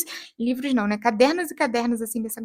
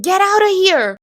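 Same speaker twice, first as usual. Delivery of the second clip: high, loud dramatic shout; imitated character's outburst; 7 semitones higher, 9 dB louder, slower than usual. Character in the replayed scene, acted angry shout. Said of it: "a pessoa falava assim".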